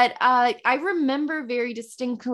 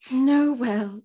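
put-on British accent. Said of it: "my British accent is crap now".

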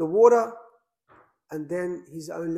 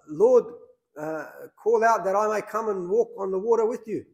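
raised voice, mildly acted call to the Lord; 5 semitones higher, 5 dB louder than usual. Peter calling to our Lord from the boat, calling out. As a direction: call across the water, lightly acted.

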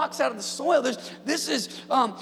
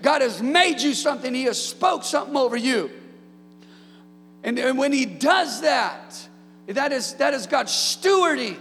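full-force preaching; 4 semitones higher, 4 dB louder than usual. Acted. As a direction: proclaim it to the congregation with fire.

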